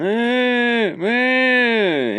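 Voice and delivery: high, drawn-out fake wail; loud, hammed-up cry; mock bawling. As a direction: imitate a woman bawling in a long whine. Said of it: "started crying and just bawling".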